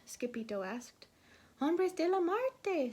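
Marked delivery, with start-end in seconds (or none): 1.6-2.9 shivering. A narrator's reading. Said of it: "with a shiverito".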